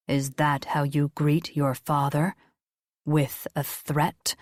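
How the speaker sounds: low, slow and measured, ancient being; solemn deep voice of an angel. Acted. Angel speaks to son Jacob.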